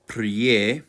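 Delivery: "prie" as pryeh, one syllable; pronunciation model. Shown as a demonstration. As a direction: say "prie" with a y-glide after the r. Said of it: "represented by my I P A P".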